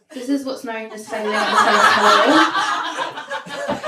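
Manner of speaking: loud and slow, breaking into laughter; laughing through it. Real, not acted. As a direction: push the line out while cracking up. Repeated punchline, laughing.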